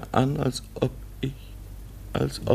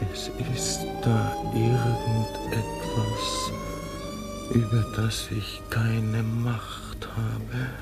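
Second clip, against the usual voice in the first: softer, slow words of dread; slow, hushed confession; 5 dB quieter, slower than usual. Acted. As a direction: confide something uncanny, slowly. Man confides eerie feeling. (langsam, gedämpft, unheimlich)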